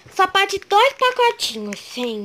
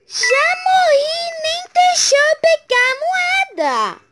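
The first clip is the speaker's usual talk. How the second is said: high, slow wail of protest; raging gamer; 9 semitones higher, 3 dB louder, slower than usual. Real, not acted. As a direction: whine about dying first. Killed by another player.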